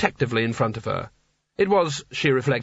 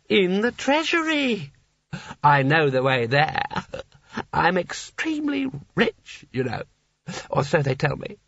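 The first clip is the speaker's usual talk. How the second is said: tipsy Seraph; 4 semitones higher than usual. A narrator's reading. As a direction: brag dreamily, unsure of it. Seraph bragging vaguely.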